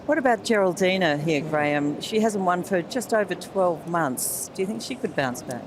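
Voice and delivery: normal talking voice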